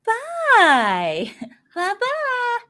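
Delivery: high, drawn-out Southern byes; grandson's Southern accent. Retold or imitated. Grandson saying goodbye, imitated high Southern drawl. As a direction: imitate a child's Southern bye-bye. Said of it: "my grandson's getting a Southern accent".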